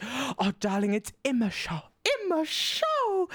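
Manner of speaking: very high, drawn-out diva voice; soaring theatrical drag mother, lingering on show; drag mum Yuvelia. Retold or imitated. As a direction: stretch the last words up high like a grand dame. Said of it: "She's like".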